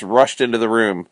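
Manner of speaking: ordinary delivery, nothing marked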